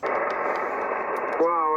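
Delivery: normal talking voice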